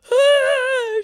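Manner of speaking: loud, high-pitched fake sob; mock crying wail. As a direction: wail like a hammed-up crier. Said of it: "She's now crying. She's like".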